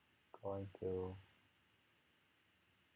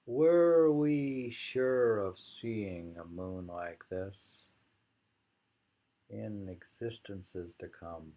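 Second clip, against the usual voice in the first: slow, deliberate poetry recital; poem reading; 9 dB louder, slower than usual. Acted. Reader reciting a mountain poem, slow and deliberate. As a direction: read it out slowly like a poem.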